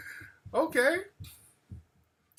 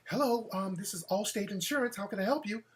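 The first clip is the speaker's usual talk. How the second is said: professional phone voice; 10 dB quieter than usual. Retold or imitated. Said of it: "She sounded professional".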